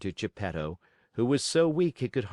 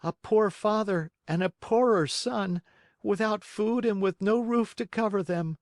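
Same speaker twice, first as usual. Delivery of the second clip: put-on high, pleading boy; humble puppet boy; 12 semitones higher than usual. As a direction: beg for shelter humbly. Pinocchio answers door.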